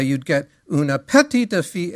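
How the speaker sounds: "petita" with a full final a; wrong petita. Shown as a demonstration. Teacher showing the wrong sung French. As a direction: sing "una petita" with sounded endings. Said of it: "which is all wrong".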